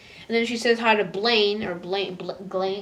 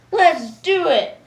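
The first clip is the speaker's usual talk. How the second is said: slow, loud, higher rallying cry; eager cheer; 8 semitones higher, 9 dB louder, slower than usual. Retold or imitated. Star ready for action, loud cheer. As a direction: psych yourself up.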